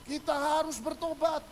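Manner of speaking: high, slow, emphatic preacher's call; preaching pastor. Acted. Pastor preaching repentance to the congregation, high preacher's call. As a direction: proclaim it like a pastor urging the congregation. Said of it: "Pendeta khotbah".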